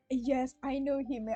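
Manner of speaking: ordinary talk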